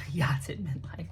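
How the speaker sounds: unvoiced whispered repeat through suppressed laughter; breathy amused repeat. Real, not acted. Vlogger catching their own slip of the tongue, whispery amusement.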